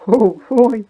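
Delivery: high, loud excited cry; loud exclamation. Real, not acted. Player reacting to the game, loud excited yelp. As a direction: let it burst out high and loud.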